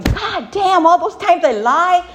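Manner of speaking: loud, high, slowed amazed exclaim; realizing all his lies, amazed and laughing; stunned laughing realization. Retold or imitated. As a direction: laugh in disbelief at yourself. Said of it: "I walked in my office going".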